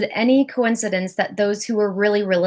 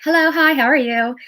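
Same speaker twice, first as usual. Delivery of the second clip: a higher, chirpy hello to dodge the task; bright fake greeting; 5 semitones higher than usual. Procrastinator dodging work with a cheery, higher hello. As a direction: brush it off with a cheery hi.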